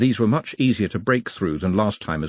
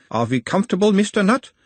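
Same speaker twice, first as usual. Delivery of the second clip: put-on a mild Uberwaldian accent, the higher voice of a gentle examiner; 11 semitones higher, 3 dB louder than usual. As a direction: question him in a light Uberwald accent, calming the patient. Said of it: "in a mild Uberwaldian accent".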